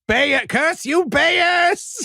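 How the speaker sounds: high-pitched mock villain cry; hammy, drawn-out villain curse; foiled villain. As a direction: curse your enemy melodramatically. Acted villain foiled by the pills.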